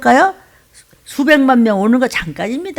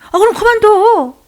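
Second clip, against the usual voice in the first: much higher, louder mock divine voice; mock God's curt reply; 11 semitones higher, 5 dB louder than usual. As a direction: play God tossing it back. God answering the complaining preacher.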